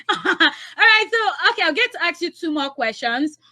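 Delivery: high, laughing through words; amused laughter. Real, not acted. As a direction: keep giggling as you go on. Host still laughing after answer.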